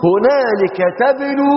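slow, high chanted verse; Quran recitation. Acted.

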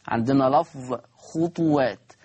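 usual speaking voice

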